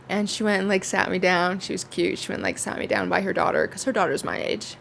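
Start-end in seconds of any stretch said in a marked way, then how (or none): none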